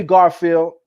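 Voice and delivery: normal talking voice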